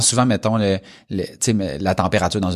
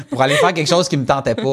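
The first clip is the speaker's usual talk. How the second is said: laughing; 7 semitones higher, faster than usual. Real, not acted. Laughing at his own long drive.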